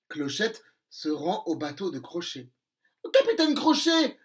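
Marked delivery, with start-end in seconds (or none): 3.1-4.2 petite fée vexée. Acted. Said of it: "lui dit-elle".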